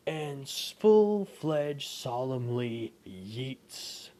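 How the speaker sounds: ordinary delivery, nothing marked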